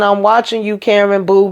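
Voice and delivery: normal talking voice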